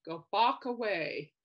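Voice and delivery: slow, deliberate "Go Bach away"; slow punchline. Retold or imitated. Repeating Mickey's pun for viewers, slow. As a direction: lean on "Bach" and drag it out. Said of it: "He goes".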